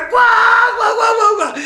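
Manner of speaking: high loud cackling laugh; helpless laughter. Retold or imitated. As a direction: laugh uncontrollably. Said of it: "E o cara".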